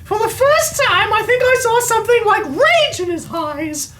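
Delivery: high, light squeaky lady voice; high Minnie Mouse voice. Acted. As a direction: pitch it up like a cartoon mouse. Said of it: "I'm starting to sound like Minnie Mouse".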